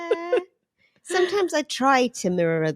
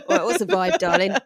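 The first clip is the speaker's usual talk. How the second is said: lower, posh darling voice; posh imitation; 6 semitones lower than usual. Shown as a demonstration. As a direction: play it grand and fancy, as an aristocrat. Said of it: "[name] redoing her own line".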